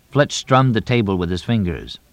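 ordinary delivery, nothing marked

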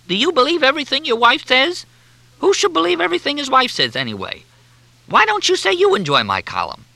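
very high, indignant outburst; exasperated rant. A narrator's reading. Columnist challenges the captain about his wife, exasperated.

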